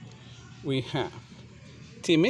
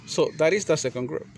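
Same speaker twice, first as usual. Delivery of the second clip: normal talking voice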